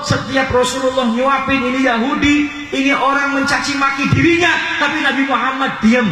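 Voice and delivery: high-pitched, full-force sermon cry; impassioned preaching. Real, not acted. Preacher telling of the weeping companion, impassioned high delivery.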